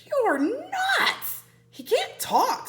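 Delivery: high, scolding; exasperated boy. Acted. Jack scoffing at his sister, high and exasperated. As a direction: scoff at her idea.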